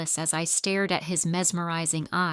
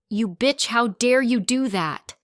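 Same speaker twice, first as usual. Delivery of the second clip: loud furious shriek, higher and louder; screaming in fury; 5 semitones higher, 6 dB louder than usual. Acted. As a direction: scream the insult at her rival. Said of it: "Clara gave out an ear-piercing scream".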